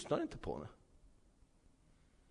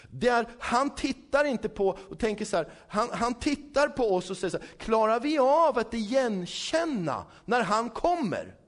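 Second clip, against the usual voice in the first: raised, higher preaching voice; fervent preaching; 5 semitones higher, 4 dB louder than usual. Acted. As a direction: preach it out to the congregation. Preacher building intensity.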